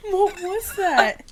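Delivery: loud, slow, laughing; spooked laughter. Real, not acted. Friends startled mid ghost story, laughing loudly that it's too spooky. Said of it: "getting a little too spooky".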